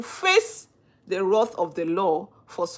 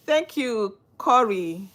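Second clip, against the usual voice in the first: ordinary delivery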